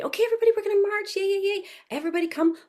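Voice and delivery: very high, excited rallying of toddlers; high-pitched preschool cheerleading; animated teacher voice. Shown as a demonstration. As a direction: call the little dancers to march with gleeful energy. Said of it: "So I'm in and out".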